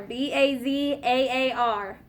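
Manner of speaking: each letter of "B A Z A A R" said slowly and separately; spelling out letters. Shown as a demonstration. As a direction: dictate the letters one by one for the class. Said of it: "Right, bazaar".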